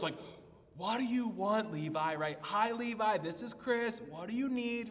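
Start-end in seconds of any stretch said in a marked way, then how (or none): 0.8-2.1 higher, louder exasperated phone answer; frustrated tone. Retold or imitated. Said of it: "the kind of tone that was in my voice". 2.4-4.9 acted exasperation, pitched higher; frustrated phone greeting. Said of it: "a deep moment of frustration".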